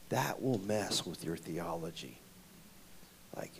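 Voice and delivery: ordinary talk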